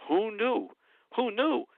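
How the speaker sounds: high, loud mocking echo of the president's words; mocking Trump. Scornfully echoing the president's claim to the panel. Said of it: "when he makes that statement".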